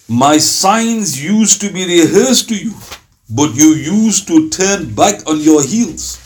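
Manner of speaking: slow, weighty scripture reading; solemn verse reading. Acted. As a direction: read the verse slowly, like holy text. Reciting verse translation aloud.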